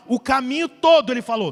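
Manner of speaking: normal talking voice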